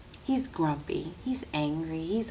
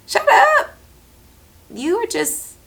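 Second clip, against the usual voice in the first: playful shut up; 4 dB louder than usual. Real, not acted. Playful mock reaction.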